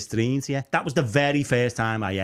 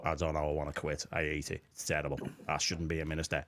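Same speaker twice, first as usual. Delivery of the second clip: much softer, low, defeated grumble; mock glum moan; 7 semitones lower, 11 dB quieter than usual. Minister telling people he wants to quit, mock despairing mumble. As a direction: mumble it like you've given up. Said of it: "And I'll go".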